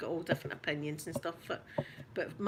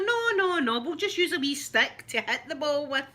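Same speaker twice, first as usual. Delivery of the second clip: high, louder mock drunk Scot; Robin Williams; 7 semitones higher, 3 dB louder than usual. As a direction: protest like a tipsy inventor explaining his game. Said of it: "it'd be two drunk guys going".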